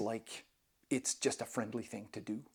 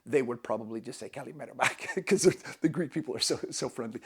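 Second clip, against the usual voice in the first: ordinary delivery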